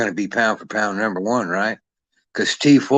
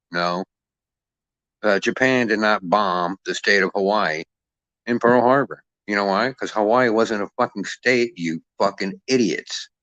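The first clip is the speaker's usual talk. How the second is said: ordinary talk, nothing marked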